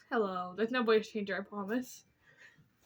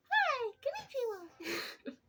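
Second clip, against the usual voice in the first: squeaky voice; slower than usual. Pretend. Silly voice aside.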